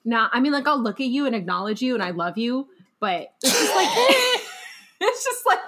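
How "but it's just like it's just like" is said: much higher and louder, laughing through it; wife laughing at her partner's morning greetings; laughing protest. Real, not acted. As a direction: giggle out the protest, incredulous.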